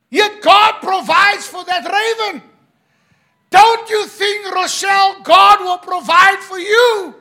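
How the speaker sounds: loud, high, ringing sermon shout; full-force preaching. Acted. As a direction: drive the point home to the congregation.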